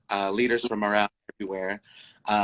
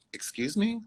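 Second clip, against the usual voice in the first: taken aback; 10 semitones higher, 4 dB quieter than usual. Retold or imitated. Elder calls mishap a skit.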